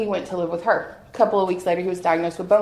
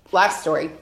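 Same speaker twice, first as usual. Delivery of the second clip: ordinary delivery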